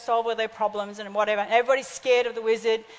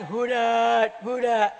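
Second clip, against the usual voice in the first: frog saying words; 2 semitones lower, much slower than usual. Retold or imitated. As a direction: imitate a frog that seems to talk. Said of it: "it sounded like he was saying".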